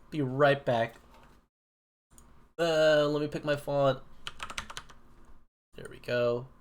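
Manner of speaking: usual speaking voice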